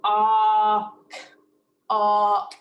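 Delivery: "Awk" as loud, long AW, then separate K; segmented sounds. Shown as a demonstration. Teacher showing two sounds, "Awk" stretched and split. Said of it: "I hear two sounds".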